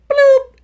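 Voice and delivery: very high, loud bloop noise; playful mock sound effect ending his game; cartoon bloop.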